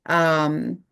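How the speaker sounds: ordinary talk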